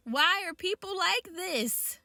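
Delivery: exasperated. Real, not acted. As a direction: ask it to the heavens, fed up. Exasperated rhetorical question.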